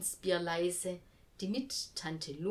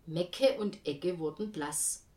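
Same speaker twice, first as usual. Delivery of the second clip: plain reading voice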